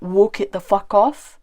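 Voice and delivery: usual speaking voice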